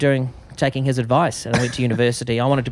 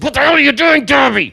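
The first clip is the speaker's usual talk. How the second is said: gruff corporal; 9 dB louder than usual. Retold or imitated. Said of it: "he said".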